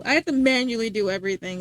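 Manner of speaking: ordinary delivery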